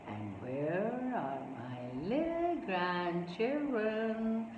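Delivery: put-on slow, quiet old grandfather's lilt; thin, soft voice. A narrator's reading. Grandpa looking for his hiding grandchildren, thin soft voice. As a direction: softly draw out each word, thin and gentle. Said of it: "a thin, quiet, soft voice".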